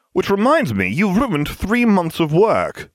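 high, indignant character voice, weight on the last words; accusing. A narrator's reading. Accusing him over ruined work.

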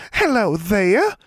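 very high, slow sing-song greeting; drawn-out, high cooing; sweet sing-song coo. A narrator's reading. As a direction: coo it sweetly to a pet bird. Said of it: "cooed in a sweet sing-song voice".

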